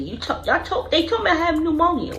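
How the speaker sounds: confused disbelief. Retold or imitated. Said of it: "I'm like".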